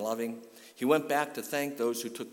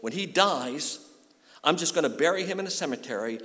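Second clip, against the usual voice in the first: high anguished outcry, louder and rushed; a mother's anguished cry, pitched high and fast; cried out; 7 semitones higher, 6 dB louder than usual. Retold or imitated. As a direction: burst out in grief. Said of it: "and she cried".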